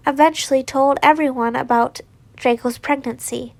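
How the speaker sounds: plain reading voice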